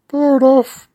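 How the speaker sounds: high, loud squeaky owl reply. Acted. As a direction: answer as a silly owl.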